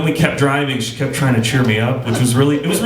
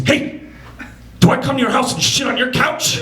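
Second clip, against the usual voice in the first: a hint of an angry teacher; ranting at the kid, higher up; mock angry teacher; 10 semitones higher than usual.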